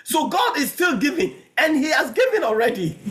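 high, punchy pulpit phrases; fervent preaching. Real, not acted. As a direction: hammer each phrase out to the congregation.

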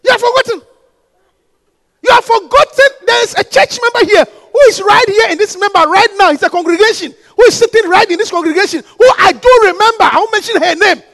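fiery sermon delivery; preaching at full force. Real, not acted. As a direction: hammer it home to the church.

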